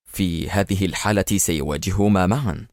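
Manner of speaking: ordinary delivery, nothing marked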